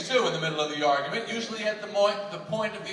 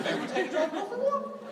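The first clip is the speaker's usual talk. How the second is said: very high, sweet-talking suitor; higher flirting voice; 11 semitones higher, 4 dB quieter than usual. Shown as a demonstration. Man suggesting a date in pursuit phase, higher voice. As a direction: pitch it way up to please her. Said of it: "all men speak higher".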